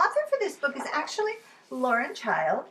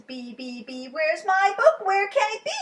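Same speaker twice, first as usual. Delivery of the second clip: hunting along the shelf; searching Lola; 10 semitones higher, 6 dB louder than usual. Acted. Lola searching the B shelf, rising to loud.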